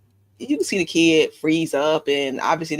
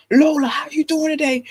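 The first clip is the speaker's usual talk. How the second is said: put-on louder, strong booming greeting to a toddler; imitating husband's booming voice; 12 semitones higher, 5 dB louder than usual. Shown as a demonstration. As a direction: boom "Lola" like a big scary dad. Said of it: "That's what you sound like to her".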